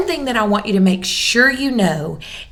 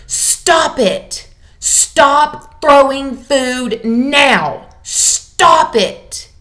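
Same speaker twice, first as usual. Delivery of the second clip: louder, higher, slow angry parent shout; yelling at toddler; 4 semitones higher, 6 dB louder, much slower than usual. Acted. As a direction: bark it across the kitchen at a food-throwing child. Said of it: "you yell at your toddler".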